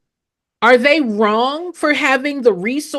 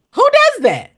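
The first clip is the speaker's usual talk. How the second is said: incredulous challenge; 13 semitones higher, 4 dB louder than usual. Acted. Rhetorical challenge to audience.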